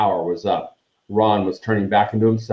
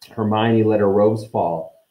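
plain reading voice

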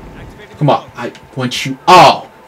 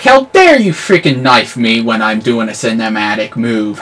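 usual speaking voice

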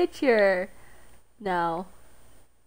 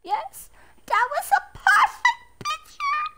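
high silly voice; 5 dB louder than usual. Pretend. Singing praise of the picture.

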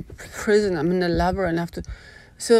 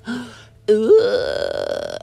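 gasp and ugh; 4 semitones higher, 5 dB louder than usual. Real, not acted. Disgust at the memory.

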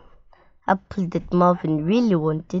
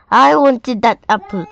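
louder, higher angry shout; 4 semitones higher, 6 dB louder than usual. A narrator's reading. Marvin yelling at Molly for eating his apple, loud and angry. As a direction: yell it, furious the apple is gone. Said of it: "shouted Marvin".

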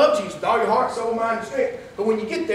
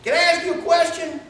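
a raised holler across the field, higher; 5 semitones higher than usual. Retold or imitated. Driver calling out to a farmer in the field, hollering. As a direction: call out to the farmer from the car window. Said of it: "he hollers at the farmer".

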